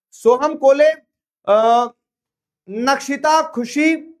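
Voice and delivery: high, loud announcer-style name reading; leaderboard roll-call. Real, not acted.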